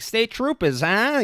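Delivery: high-pitched hammed-up Boston comic; mock Boston guy. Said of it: "I don't wanna be like".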